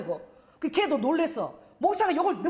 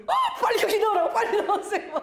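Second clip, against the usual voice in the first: higher, louder hurried urging; panicked urging; 8 semitones higher, 3 dB louder than usual. Retold or imitated. Pastor urging grandmother in a laughing panic. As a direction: rush her to put it in.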